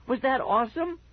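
very high, thrilled question; happy yelp. A narrator's reading. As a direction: crow with delight to a friend. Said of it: "I yelped happily".

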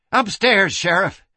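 high, urgent direction; urgent gasp. Acted. Madam points the sheriff upstairs, urgent gasp. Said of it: "Kitty gasped".

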